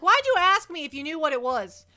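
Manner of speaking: loud, indignant, sassy comeback; hammed-up indignation; mock exasperated rant. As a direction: replay the outrage at the customer. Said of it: "I'm like".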